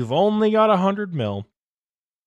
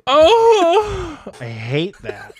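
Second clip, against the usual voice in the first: disgusted; 9 dB louder, much slower than usual. Real, not acted. Disgusted reaction to kid voice.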